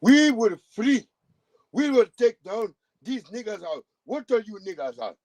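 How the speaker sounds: high, forceful mock African accent; harsh African accent. Mocking a woman's harsh accent, loud African-accented declaration. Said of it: "That accent is hard. It's harsh".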